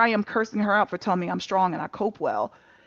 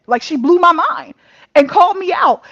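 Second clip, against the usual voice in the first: louder, higher amazed exclamation; exclaiming; 8 semitones higher, 9 dB louder than usual. Real, not acted. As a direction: burst out about being called out. Exclaiming about being called out.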